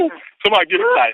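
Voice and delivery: rally the listeners, bursting with joy; excited hype. Real, not acted. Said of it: "I am elated".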